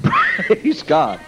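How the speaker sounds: ordinary talk, nothing marked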